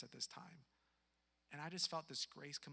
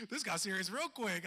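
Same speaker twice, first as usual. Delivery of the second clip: laughing; 5 semitones higher, 10 dB louder than usual. Real, not acted. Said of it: "Oh, snap".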